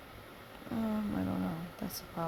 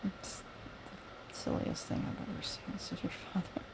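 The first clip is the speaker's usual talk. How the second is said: whisper; 7 dB quieter than usual. Acted. Whispered read line.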